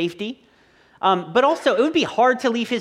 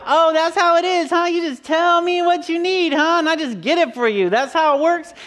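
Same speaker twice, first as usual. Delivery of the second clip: sarcastic dad; 8 semitones higher, 4 dB louder than usual. Retold or imitated. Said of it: "I'm like".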